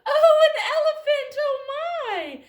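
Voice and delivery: very high, very loud amazed cry; high amazed mouse; astonished squeal. Acted. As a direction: squeal in delighted shock. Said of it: "And she said".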